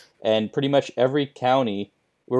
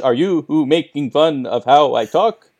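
usual speaking voice

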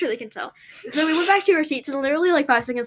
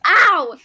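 sudden loud, high "ow"; real cry of pain; pained yelp; 5 semitones higher, 7 dB louder than usual. Real, not acted. Being bitten while talking.

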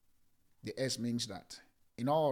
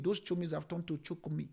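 ordinary delivery

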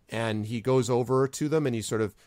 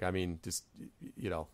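ordinary delivery

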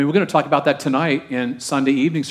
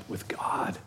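hushed whisper of intimacy; 14 dB quieter than usual. Real, not acted. Pastor confiding intimacy with God, whispered. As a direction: drop to a whisper to draw them in.

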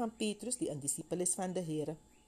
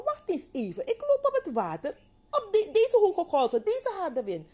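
very high, mounting alarm; dramatized sudden panic, pitched way up; acted alarm; 7 dB louder than usual. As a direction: grow louder as the danger dawns. Peter notices waves, begins sinking.

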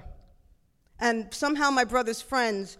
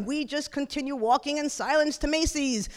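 ordinary delivery

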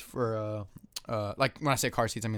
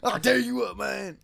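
high-pitched mock country holler; country dude voice; 8 semitones higher than usual. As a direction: crack up your friend with country talk. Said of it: "That voice?".

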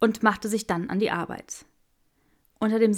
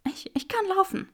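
shaky, higher, weak voice; weak breathless; 12 semitones higher, 3 dB quieter than usual. A narrator's reading. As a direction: push it out weakly. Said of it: "keuchte Granger".

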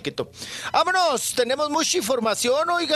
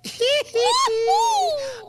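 high laugh. Real, not acted. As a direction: crack up laughing. Laughing at the teasing. (carcajada aguda)

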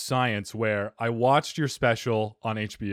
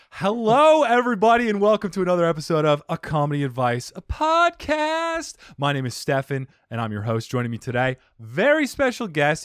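show host introduction; 4 semitones higher, 4 dB louder than usual. Acted. Host introducing a special guest to everybody, announcer style. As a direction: announce it, building to a loud final name.

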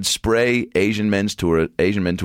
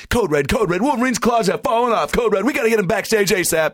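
high-pitched mock emergency call; urgent alarm. Park staff rushing a performer off stage, urgent mock alarm.

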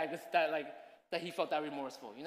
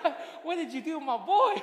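louder, higher, laughing disbelief; laughing mock outrage; 9 semitones higher, 9 dB louder than usual. Father laughing at his wife over their crying son. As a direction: laugh it out, pretending to be shocked. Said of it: "It's like".

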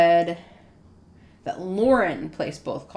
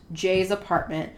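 normal talking voice

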